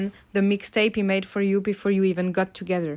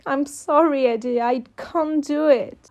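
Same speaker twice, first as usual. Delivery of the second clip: teary; 6 semitones higher than usual. A narrator's reading. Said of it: "you stop with teary eyes".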